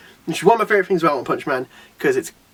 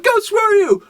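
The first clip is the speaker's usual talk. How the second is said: mock battle cry; 14 semitones higher, slower than usual. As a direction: throw it out like a hero's rallying yell. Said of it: "He's like".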